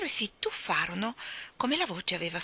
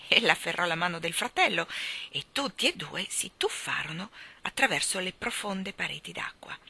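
ordinary delivery, nothing marked

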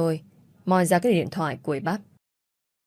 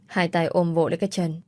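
ordinary delivery, nothing marked

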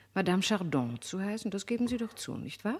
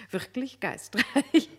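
slow, louder, laughing through it; laughing praise; 4 semitones higher, 5 dB louder, slower than usual. Acted. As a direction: chuckle at how witty it is. Marquise lobt den Artikel. (lachend und langsam gelobt)

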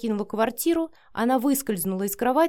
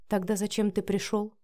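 ordinary delivery

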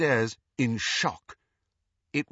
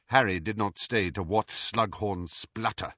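usual speaking voice

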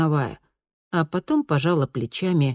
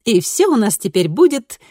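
put-on higher, brighter girl voice; lightly voiced cheerful teen girl; bright daughter voice; 6 semitones higher, 5 dB louder than usual. A narrator's reading. Daughter promising.

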